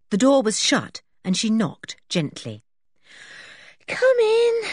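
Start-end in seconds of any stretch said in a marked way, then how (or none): 3.9-4.6 high, drawn-out sleepy call. A narrator's reading. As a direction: call out drowsily from bed, high-pitched. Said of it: "said Anne's sleepy voice".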